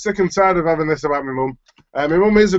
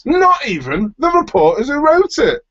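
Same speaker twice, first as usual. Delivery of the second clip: mock punchline; 9 semitones higher, 3 dB louder than usual. Joking high slow punchline.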